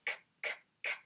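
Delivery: crisp unvoiced k clicks like clappers. Shown as a demonstration. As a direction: click out the k sounds. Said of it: "my concert clappers".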